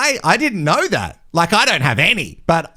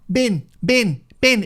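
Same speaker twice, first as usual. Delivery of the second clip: put-on Kiwi-accented "bin", pitched up; 4 semitones higher, slower than usual. Playing on Ben's name, Kiwi accent. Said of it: "If you say it in a Kiwi accent".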